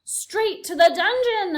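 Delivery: high, loud, slow mob shout. A narrator's reading. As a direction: demand it from the crowd. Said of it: "another voice shouted".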